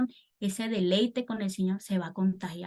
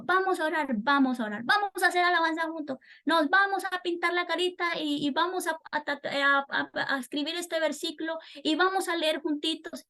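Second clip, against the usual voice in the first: put-on high, lilting kid-talk, much higher than the sermon; singsong teacher talking to small kids; sweet voice for little children; 8 semitones higher than usual. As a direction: coax the little ones along like a Sunday school teacher. Voicing how to talk with children.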